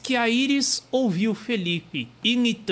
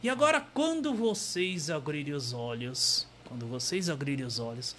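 softer calm suggestion; soft hypnotist; 4 semitones lower, 4 dB quieter than usual. Acted. Hypnotist calming them, soft voice. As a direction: lull gently.

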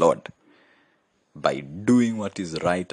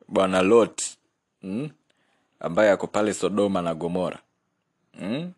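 usual speaking voice

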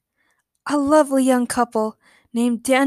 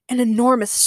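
ordinary delivery

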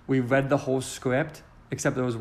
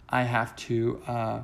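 ordinary talk

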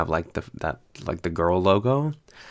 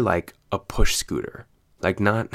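ordinary talk, nothing marked

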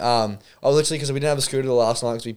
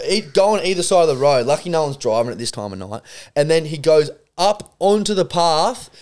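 normal talking voice

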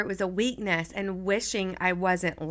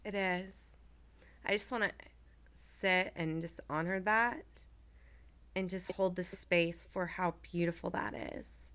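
soft, hushed and tender; quietly holding space for someone; tender reverence; 9 dB quieter than usual. Real, not acted. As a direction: speak gently, leaving long pauses to honor it. Holding space after emotional share.

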